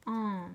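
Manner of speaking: "an" held as one long nasal vowel; sustained teaching "an"; isolated sound. Shown as a demonstration. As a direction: sound out "an" after a small pause. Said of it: "Ça fait".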